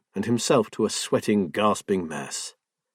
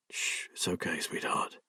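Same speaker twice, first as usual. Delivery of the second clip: soft, near-whispered reassurance; hushed comfort; 4 semitones lower, 12 dB quieter than usual. Acted. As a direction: soothe her very quietly. Husband comforts crying wife.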